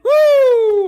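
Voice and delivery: long, very high, loud "woo"; fired-up whoop. Real, not acted. Host worked up about sinners, fired-up whooping. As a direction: let out a soaring, sustained whoop. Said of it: "I'm getting hot".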